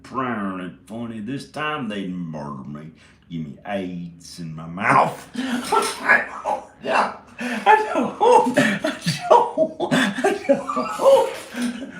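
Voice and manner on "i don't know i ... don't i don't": high, breathless real laughter through words; laughing. Real, not acted. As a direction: laugh so hard words squeak. Laughing at his own joke.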